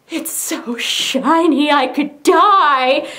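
slow, drawn-out gushing squeal; overwhelmed delight. Retold or imitated. Opening the package and seeing the case, drawn-out delight. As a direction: stretch every word in hammed-up rapture.

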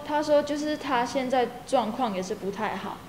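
ordinary talk

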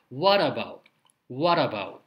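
what about as "warabau", T flapped to ラ; what about said "wara-bau". Shown as a demonstration. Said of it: "what aboutは".

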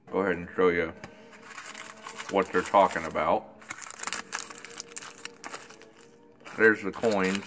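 speaking around a pipe; talking with pipe in mouth. Real, not acted. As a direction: pipe stem clenched in teeth. Seen 0: Pipe stem clenched in his mouth throughout the labelled words.

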